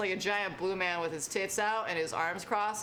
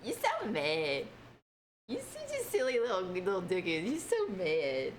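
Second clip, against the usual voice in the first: teasing coo. Retold or imitated. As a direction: coo at someone sulking. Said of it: "sometimes it's like".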